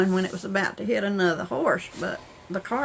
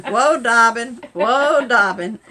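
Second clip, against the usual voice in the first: much louder whoa shouted; hollering whoa; 11 dB louder, slower than usual. Retold or imitated. Potter shouting at the car to stop like a horse. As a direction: yell whoa to halt the horse.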